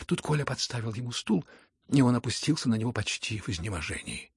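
usual speaking voice

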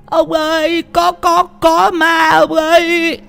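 very high, stammering wail; high-pitched panicked old woman; panting in terror. Acted. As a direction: stammer out the alarm, drawing out the end. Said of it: "thở hổn hển".

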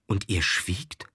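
slow, near-whispered disbelief; hushed question. A narrator's reading. The lady asking why he kept silent, hushed. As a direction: whisper it slowly, taken aback. Stunned question after the confession.

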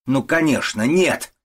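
high, loud, mocking retort; angry shouting. A narrator's reading. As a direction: shout it back at the suitor. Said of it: "кричит госпожа Мюршель". (сердитый крик)

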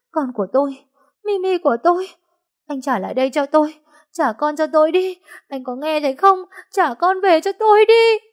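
high, sobbing wail rising to a scream; mother wailing for her dead baby; wailing plea. Acted. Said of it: "vừa khóc vừa đấm đá".

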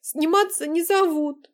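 loud slow sobbing complaint; crying on the phone. Acted. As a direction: wail it through tears. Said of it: "прорыдала в трубку Азалия".

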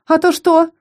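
high-pitched cocky retort; defiant, goading question; defiant challenge. A narrator's reading. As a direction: throw it back as a dare, pitched high. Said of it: "С вызовом поинтересовалась".

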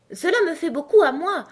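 high, loud, spirited objection; quick, lively retort. A narrator's reading. Alice objecting that it matters to her, lively. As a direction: jump in sharply, high and loud. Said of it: "dit Alice vivement".

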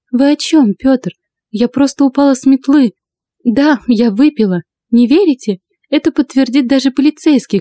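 put-on high-pitched protesting young blonde; innocent blonde. A narrator's reading. Blonde racer excusing herself to accuser, innocent. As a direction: plead innocence in a lifted voice.

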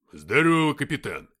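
loud, hearty greeting; exclamation. A narrator's reading. Nikita greeting the captain in battle, exclaiming. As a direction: holler hello over the noise. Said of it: "воскликнул Никита".

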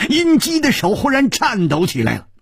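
usual speaking voice

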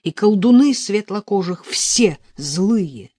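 loud, high, commanding sorcerer voice; evil sorcerer. A narrator's reading. Sorcerer ordering her to circle against the sun, commanding. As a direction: ring it out as a wicked sorcerer's decree. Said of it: "говорит злой колдун".

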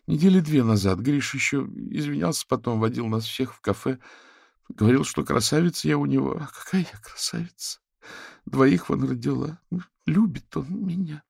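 put-on a high, distraught young woman pleading; upset young wife. A narrator's reading. Katerina tells the investigators about the bouquets, distraught. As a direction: tumble it out close to tears.

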